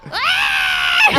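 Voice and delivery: very high, loud screech; imitated cat scream. Imitating her annoying cat for a friend, piercing mock scream. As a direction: scream like a cat being ripped apart. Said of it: "he screams like he's being ripped to shreds".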